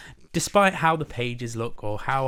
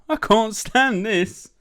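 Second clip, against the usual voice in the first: high, loud, drawn-out complaint; acted exasperated cry; exasperated bear; 11 semitones higher, 10 dB louder, much slower than usual. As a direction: wail slowly at the end of your patience. Mister Bear fed up with noise.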